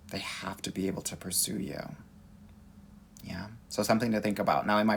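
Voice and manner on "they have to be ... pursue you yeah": soft whispered aside; whispering. Real, not acted. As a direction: lean in and whisper it. Whispered emphasis to viewers.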